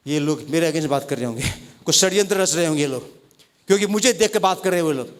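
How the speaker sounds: low, fast, quiet suspicious mutter; suspicious inner voice. Retold or imitated. (धीमी, नीची, तेज़ शक भरी बड़बड़ाहट)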